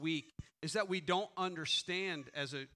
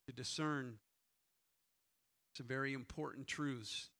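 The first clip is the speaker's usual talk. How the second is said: softer, lower prayer voice; 6 semitones lower, 5 dB quieter than usual. Acted. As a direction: pray quietly and humbly over the church. Pastor leads a prayer.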